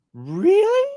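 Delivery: retelling the shock of being offered day shifts; amazed really. Retold or imitated. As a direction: gasp it out in disbelief. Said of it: "I'm like".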